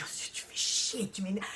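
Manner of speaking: imitating an annoyed sister, whispered; hushed, exasperated sister groaning at her; agacée chuchotée. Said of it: "j'entends déjà ma sœur qui me dit". (sœur agacée qui chuchote)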